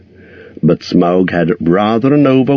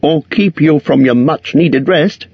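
high, courteous hobbit voice; polite hobbit; 9 semitones higher than usual. A narrator's reading. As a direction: edge away politely. Bilbo excuses himself.

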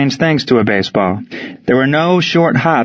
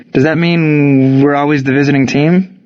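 lightly voiced higher player's question; 3 semitones higher than usual. A narrator's reading. Player asks the coach about the visiting team, questioning. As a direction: put it as a puzzled question, lightly acted.

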